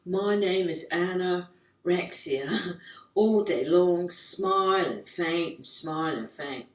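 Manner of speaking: slow, drawn-out British model voice; mock British billboard model, stretched words; British model accent. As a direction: put on a languid British accent. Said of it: "these fifteen-year-old British models".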